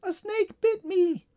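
put-on very high, slow little-girl wail; whimpering in pain. A narrator's reading. Small girl tells the guard of the bite, high pained whimper. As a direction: draw it out as a high, hurt cry. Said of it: "whimpering in pain".

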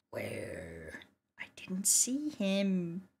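slow, hushed whisper; whispered disbelief. Real, not acted. Player who missed the man in the camera, whispered disbelief. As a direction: whisper it slowly, dumbfounded.

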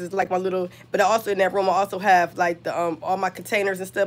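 ordinary talk, nothing marked

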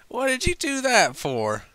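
high, loud indignant outburst; angry demand. Acted. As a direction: fire it back angrily. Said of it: "angry demanded".

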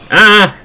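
short, loud, high "Ah!"; startled yelp. Real, not acted. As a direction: yelp as you suddenly fall. Falls through something in game.

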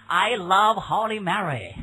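slow put-on American saying I love Holy Mary; mock voice of a careless visitor in America; mock tourist line. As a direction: ham up the naive tourist, slowly. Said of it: "不要老说".

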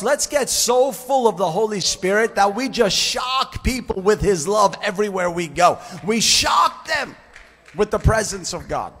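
loud, rising preacher's exhortation; full force preaching. Acted. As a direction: drive it home to the whole church.

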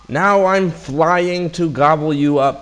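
high, loud storybook menace; hammed-up gorilla challenge; gorilla threat. A narrator's reading. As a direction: threaten loudly like a pantomime gorilla.

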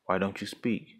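ordinary delivery, nothing marked

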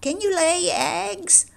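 very high, slow hen voice. A narrator's reading. Hen testing the duckling, high and clucky. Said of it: "The hen said".

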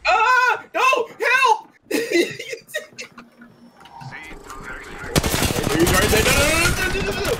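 high frantic yelps and screams; panicked screaming. Real, not acted. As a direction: shriek for help as you're overrun. Ambushed in a firefight, screaming.

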